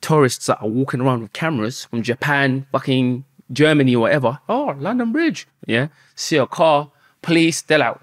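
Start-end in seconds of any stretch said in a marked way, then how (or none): 4.5-5.5 mock tourist. Tourists gawping at London Bridge.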